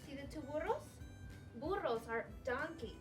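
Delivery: plain reading voice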